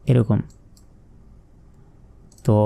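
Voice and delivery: normal talking voice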